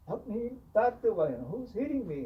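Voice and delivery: high, pleading cry for help; clown crying for help. Retold or imitated. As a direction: squeal like a clown being pelted. Clown cries out under stones.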